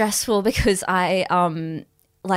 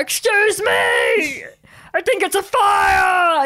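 high, slow shouted alarm; shouting fire alarm; 10 semitones higher, 4 dB louder, much slower than usual. Acted. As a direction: call out like a loud polite alarm.

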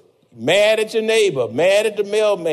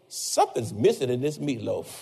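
lower, softer muttered complaint; low sulky husband picking at dinner; grumbling husband; 8 semitones lower, 7 dB quieter than usual. Retold or imitated. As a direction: grumble it under your breath at the plate. Husband grumbling at wife's cooking.

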